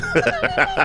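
short high laugh; quick laughing squeal. Real, not acted. Host laughing.

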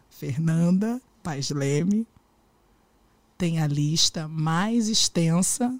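slow, soft, teasing suspense; suspenseful slow build. Acted. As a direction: drag it out like a big secret.

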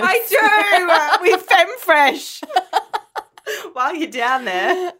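high, loud, laughing hard; cracking up mid-sentence. Real, not acted. Banter about anus washing.

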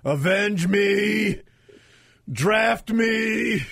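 dying hero's last cry, hammed up; overacted death scene. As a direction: cry it out like a fallen Wolverine. Red Dawn Wolverines joke.

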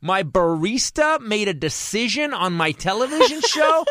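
high-pitched, drawn-out outrage; comic indignation, high and slow; incredulous rant. Retold or imitated. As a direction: stretch each phrase in exasperated disbelief. Rants in disbelief about the barista.

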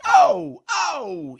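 high yelled "oh", the first one loudest; exasperated yell. Real, not acted. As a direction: cry out in exasperated disbelief. Exasperated outburst mid rant.

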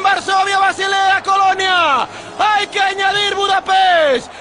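very high, slow triumphant shouting; ecstatic victory shout. Real, not acted. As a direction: scream the title to the sky. Victory call after final.

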